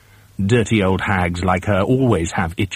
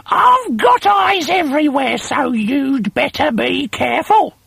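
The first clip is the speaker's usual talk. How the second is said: cackling hag. A narrator's reading. Wife gloats about her eye in his beer, high cackling hag. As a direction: crow it like a gloating witch. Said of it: "cackled Missus Twit".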